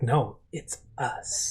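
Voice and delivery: low, slow and hushed correction; sassy retort. Retold or imitated. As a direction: draw it out low, savoring each word. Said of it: "sassing each other".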